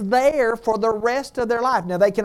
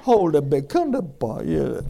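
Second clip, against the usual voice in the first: lightly mimicked toddler babble, lower voice; imitating toddler talk; 4 semitones lower, 4 dB louder than usual. Grandparent mimicking Owen with a baby, mock toddler talk. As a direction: coo over a baby like a toddler.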